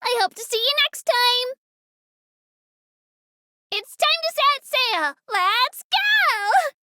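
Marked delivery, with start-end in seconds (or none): 3.7-6.8 higher, rallying cheer; excited send-off. Acted. Tour guide telling passengers it's time to sail, excited send-off. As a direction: call the boat off eagerly.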